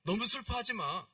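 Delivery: loud, high acted reassurance; urgent consoling. Painter trying to soothe the crying girl, urgently consoling. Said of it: "페피타를 달래기 위해서".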